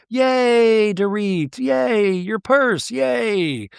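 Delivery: high, sing-song mock cheer; mock cheering ladies. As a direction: cheer like a vapid fan, mocking. Ladies cheering [name], mocked.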